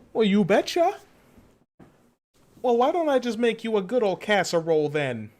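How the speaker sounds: high-pitched, hammed-up folksy Oklahoma accent. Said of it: "Oklahoma accents. A special breed of accents".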